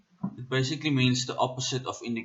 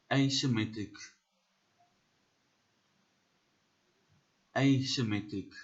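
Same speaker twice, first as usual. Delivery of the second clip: South African accent. Shown as a demonstration. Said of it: "We are doing this in a South African accent".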